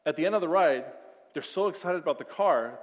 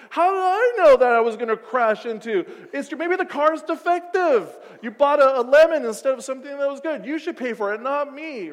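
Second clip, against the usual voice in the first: much higher, much louder mock yelling; yelling argument; 8 semitones higher, 10 dB louder than usual. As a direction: escalate into a shouting match. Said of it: "voice gets higher and higher".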